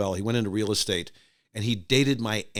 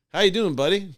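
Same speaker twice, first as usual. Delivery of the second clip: hearty greeting; 7 semitones higher, 5 dB louder than usual. Retold or imitated. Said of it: "the guy says".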